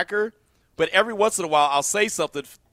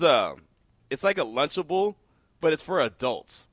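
usual speaking voice

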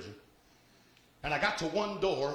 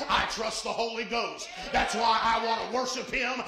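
preaching at full force; 7 semitones higher, 5 dB louder than usual. Acted. Preacher to congregation, roaring warning about the flesh. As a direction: build it up to a shout from the pulpit.

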